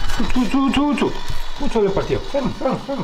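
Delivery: high coaxing pet voice; talking to a dog. Pretend. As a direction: urge it on like a puppy. Talks to robot like a dog. (come si parla a un cane)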